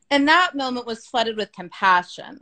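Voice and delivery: ordinary talk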